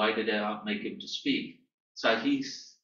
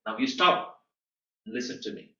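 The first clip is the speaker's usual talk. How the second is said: mock stern command, punched start then dropping; half-hearted imitation of a bossy order; imitating an angry diplomat. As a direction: act out a diplomat banging the table and ordering silence. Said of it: "This is how exactly they say".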